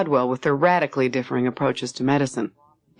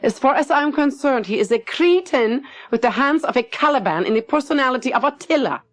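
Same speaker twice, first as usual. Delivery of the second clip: much higher, contemptuous tirade; scornful Lottie; 10 semitones higher than usual. Acted. Lottie scorning the new surgeon to Max, higher and biting. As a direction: spit out the insult with disdain.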